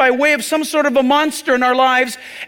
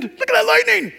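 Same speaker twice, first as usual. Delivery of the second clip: much higher, thrilled yelp at the lightning; excited storm chaser; 12 semitones higher than usual. Retold or imitated. Preacher mimics storm chaser spotting lightning, excited yelp. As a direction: play a giddy storm chaser spotting a bolt. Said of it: "those storm chaser guys".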